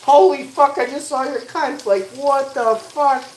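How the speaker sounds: very high, loud shriek of disbelief; hammed-up panic, slowing on the final curse; full freak-out. Man imagining the shock of being flashed, hysterical outburst. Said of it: "And I'll snap".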